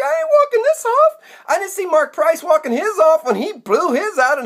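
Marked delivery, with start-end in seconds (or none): 0.0-1.1 very high, incredulous protest; indignant refusal. Acted. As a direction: squawk back in outraged disbelief. Refuses to walk off injury.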